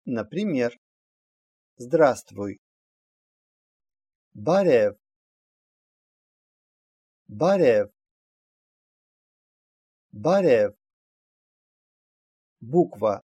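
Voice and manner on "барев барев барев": "Барев" with a voiced initial b; teacher's careful isolated "Барев", b kept voiced; demonstrated pronunciation. Shown as a demonstration. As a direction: model "Барев", starting on a clear b. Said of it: "всегда произносится «б»".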